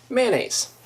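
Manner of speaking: "mayonnaise" squeezed into MAN-naze; high, falling two-syllable "mayonnaise", MAN-naze; two-syllable version. Shown as a demonstration. Showing viewers their own way, two-syllable version. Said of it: "Two syllables for me".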